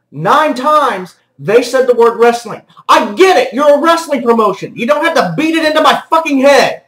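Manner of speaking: high-pitched, worked-up ranting; fed-up, punchy outburst; exasperated rant. Real, not acted. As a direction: vent your irritation, voice climbing. Exasperated rant at wrestling promos.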